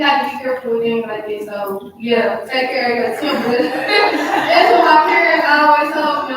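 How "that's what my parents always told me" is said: loud, slow words through laughter; amused, loud and drawn out; laughing. Real, not acted. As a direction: laugh your way through the line. Laughs while quoting parents' advice.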